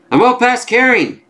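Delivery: high-pitched fed-up retort; defiant outburst. Acted. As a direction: throw it back, past all patience. Comic character snaps back mid-fight.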